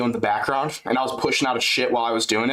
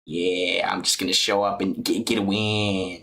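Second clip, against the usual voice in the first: lower, lazy fighter voice; JDM impression; 6 semitones lower than usual. Doing a JDM impression for chat, lower voice. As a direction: shrug it off like JDM. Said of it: "good impression of him".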